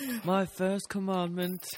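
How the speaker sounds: high, slow, mock-solemn proclamation; hammed-up commandment like a prophet; mock preacher. Mock preaching about [name].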